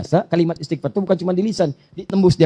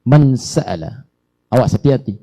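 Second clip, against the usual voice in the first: ordinary talk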